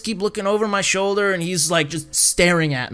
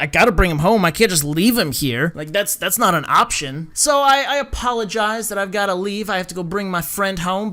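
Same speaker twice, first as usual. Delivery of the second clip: ordinary talk, nothing marked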